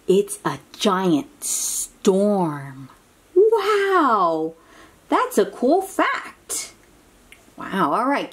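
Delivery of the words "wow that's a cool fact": excited wow. Acted. Reacts to fact for child.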